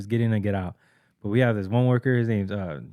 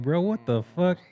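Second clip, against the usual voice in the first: mock outrage; 8 semitones higher, slower than usual. Outraged at bathroom stranger.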